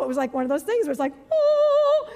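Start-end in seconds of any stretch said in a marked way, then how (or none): 1.3-2.1 very high, loud oh; sudden realization. Retold or imitated. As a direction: gasp of realizing.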